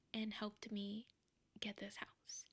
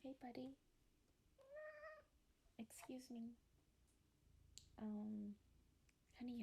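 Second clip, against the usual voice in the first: hushed, tender pet talk; cooing to cat; 7 dB quieter than usual. Real, not acted. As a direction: coo softly to the cat as it interrupts. Whispering sweetly to her cat.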